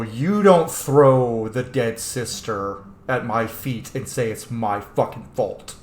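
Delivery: ordinary talk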